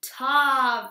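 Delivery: now say it out for the whole class; out loud. Shown as a demonstration. Teacher showing kids how to say the sound aloud. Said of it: "and then say it out loud".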